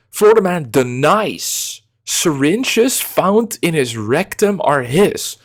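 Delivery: slow, high dramatic headline read. Shown as a demonstration. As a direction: read it out like a big news headline. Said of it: "Let me read the headline".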